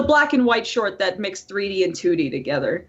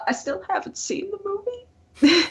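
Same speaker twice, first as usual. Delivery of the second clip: mock crying; 10 semitones higher than usual. Mock despair over unseen movie.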